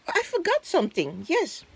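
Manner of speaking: ordinary talk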